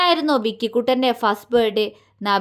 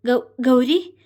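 startled call; slower than usual. A narrator's reading. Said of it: "അവൻ പകപ്പോടെ വിളിച്ചു".

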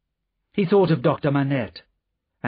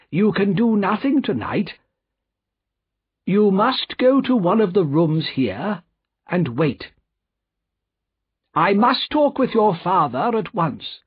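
high, insistent banker giving instructions; urgent Mister Lorry; 8 semitones higher than usual. Acted. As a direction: press her firmly, pitched up. Lorry directs Lucy.

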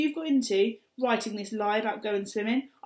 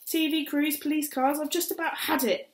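mum's frantic rant; 6 semitones higher, 5 dB louder than usual. Acted. Mum ranting about neighbours.

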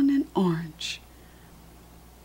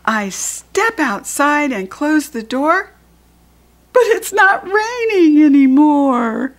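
rising higher, ending in a loud happy cry; excited storytime reading; 7 semitones higher, 8 dB louder than usual. Acted. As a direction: build from eager to a joyful shout. Reads the rhyme, voice climbing.

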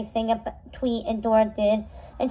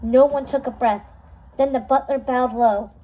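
usual speaking voice

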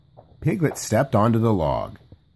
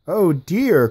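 fretful cry; 8 semitones higher, 5 dB louder than usual. A narrator's reading. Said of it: "cried Piglet".

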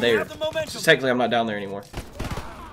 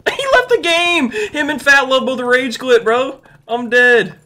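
higher, drawn-out excited exclamation; gleeful crowing; 5 semitones higher, slower than usual. Real, not acted. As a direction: crow with glee at a rival quitting. Crowing over opponent rage quitting.

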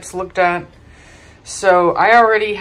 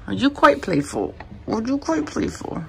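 higher cooing pet voice on the repeat; 7 semitones higher, 3 dB quieter than usual. Real, not acted. Owner teasing her playful puppy, cooing baby talk. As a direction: coo the question at the puppy.

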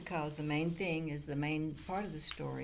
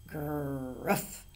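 louder snapped bleat from the biggest goat; snapping big goat; 5 dB louder than usual. A narrator's reading. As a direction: snap it out like a big billy goat. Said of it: "snapped the biggest billy goat gruff".

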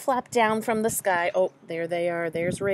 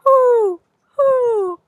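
high, loud drawn-out hoots; owl hooting; 12 semitones higher, 11 dB louder, much slower than usual. A narrator's reading. Owl comes out of the hole, hooting loudly. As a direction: hoot it out like an owl.

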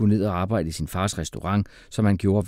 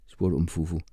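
usual speaking voice

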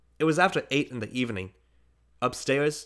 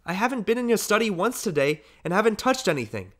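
put-on much higher, bewildered child; small boy; 9 semitones higher, 4 dB louder than usual. A narrator's reading. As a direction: protest like an innocent little boy. Boy voiced by narrator.

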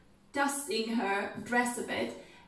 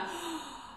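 gasp; 7 semitones higher, 9 dB quieter than usual. Shown as a demonstration. Gasp of remembering good news.

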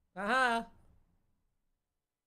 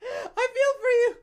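much higher, slow, whiny fake pity; mock sympathy; 14 semitones higher, slower than usual. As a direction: tease the opponent with fake pity.

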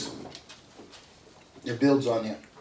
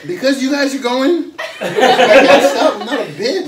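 higher and louder, laughing through it; 12 semitones higher, 11 dB louder, slower than usual. Real, not acted. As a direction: laugh helplessly while talking. Laughing, can't stop eating wings.

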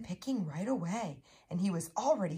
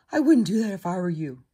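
loud, quick little warning; lightly voiced mouse, fast and loud; squeaky mouse voice; 8 dB louder, faster than usual. A narrator's reading. Mouse warns the quacking duckling, quick and lightly squeaky. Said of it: "said a squeaky voice".

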